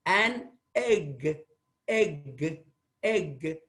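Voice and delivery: "egg" said slowly three times, vowel from the throat; model pronunciation egg. Shown as a demonstration. Teacher modelling "egg" for the students, slow and clear. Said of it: "I am supposed to pronounce".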